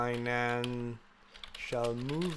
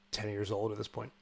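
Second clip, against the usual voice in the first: ordinary talk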